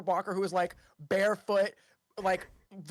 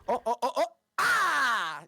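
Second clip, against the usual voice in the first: comic yelps; 5 semitones higher, 4 dB louder, slower than usual. Shown as a demonstration. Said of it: "you know, like".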